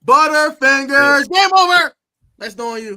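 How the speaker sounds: taunting shout. Retold or imitated. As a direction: yell the taunt across the stands. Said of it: "I said".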